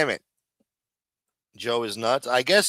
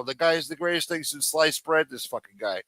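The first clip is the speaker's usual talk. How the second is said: usual speaking voice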